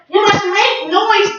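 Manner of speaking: high, slow insistent shout; shouted command. Acted. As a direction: urge them with all his might. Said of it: "he shouted".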